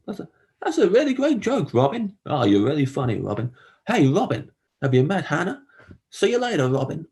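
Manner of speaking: high, gushing, keeps hitting the name Robin; overeager name dropper. Retold or imitated. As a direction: oversell every Robin. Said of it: "I say it too much all the time".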